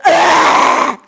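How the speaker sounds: sustained harsh mock growl; imitated angry growl. Showing how the man left the market, imitated growl. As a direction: growl out an aggressive exit noise. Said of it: "This is how he left".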